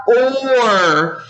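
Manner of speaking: "or" stretched long on its vowel; drawn-out O sound. Shown as a demonstration. Teacher shows the next syllable, "or" with drawn-out O. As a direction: hold the O in "or" before the R. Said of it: "making the O sound".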